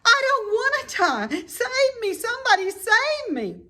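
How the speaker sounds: very high, loud frantic cries; panicked pleading. A narrator's reading. Wilbur panics and begs the barn for rescue. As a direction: beg for rescue in terror.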